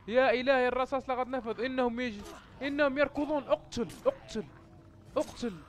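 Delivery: high, urgent exclamations; frantic high-pitched alarm; panicked. Real, not acted. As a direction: cry out with the enemy rushing in. Out of bullets, zombies running.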